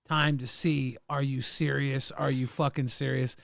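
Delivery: ordinary talk, nothing marked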